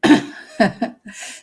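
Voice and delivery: short breathy burst of real laughter; laughing. Real, not acted. Host laughing at the news, a real burst of laughter. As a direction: laugh out at the absurdity. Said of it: "I'm laughing".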